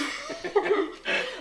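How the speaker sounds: squealing laugh; high laugh. Real, not acted. Laughing after joke.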